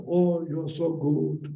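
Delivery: slow, high, drawn-out flattering praise; slow sing-song "Oh, you're so good"; mock sweet compliment. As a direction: coo it like a gushing admirer. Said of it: "And you say".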